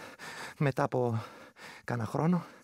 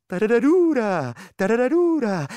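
high, loud, slow ghostly trill; loud creature call; 9 semitones higher, 8 dB louder, slower than usual. Acted. The vrykolakas calls beside the shepherd, high loud trill. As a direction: shriek it right in his ear.

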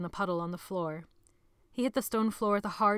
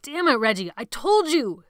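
loud, high furious yell; angry shout; 4 semitones higher, 10 dB louder than usual. A narrator's reading. Sebastian yelling at whoever opened the door. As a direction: snap at the intruder.

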